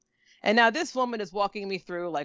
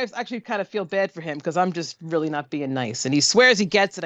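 usual speaking voice

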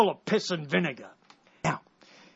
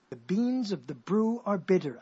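slow, deliberate "beans of the brew are bitter"; tongue twister said slowly. Shown as a demonstration. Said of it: "I'm making up a tongue twister".

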